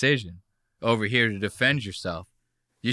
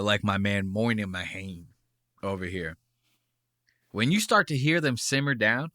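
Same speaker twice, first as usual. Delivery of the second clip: usual speaking voice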